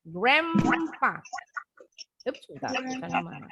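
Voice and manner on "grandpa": "Grandpa" said as "grempa", clear and falling; model pronunciation. Shown as a demonstration. Teacher showing the class how to say it, clear falling model. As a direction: say "Grandpa" like "grem-pa", ending down. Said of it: "Tadi ya pengucapannya".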